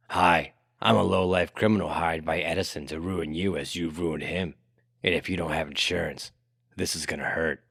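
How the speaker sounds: low, soft menacing criminal voice; quiet threat from a hired lowlife; hired thug. Acted. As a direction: play a low-voiced henchman introducing himself. Imagined hired thug threatening Tesla.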